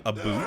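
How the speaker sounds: "a boot" in place of about; mocked Canadian about. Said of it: "Canadians for saying".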